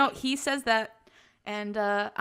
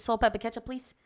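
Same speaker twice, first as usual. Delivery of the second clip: ordinary delivery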